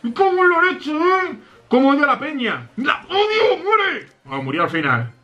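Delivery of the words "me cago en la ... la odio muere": very high-pitched angry outburst; squealing, exasperated cursing; gamer rage. Real, not acted. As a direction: shriek in frustration at the game. Rages at game opponents.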